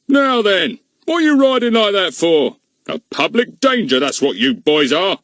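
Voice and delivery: high, loud, blustering constable's outburst; exploding policeman. A narrator's reading. Policeman scolds the reckless telegraph boy, blustering outburst. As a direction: burst out like an outraged bobby. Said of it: "exploded Mister Goon".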